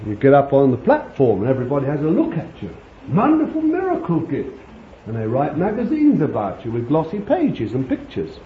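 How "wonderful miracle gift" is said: high-pitched gushing imitation; mock admiration. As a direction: gush like an awestruck admirer, hammed up. Mimics admirers of a platform healer.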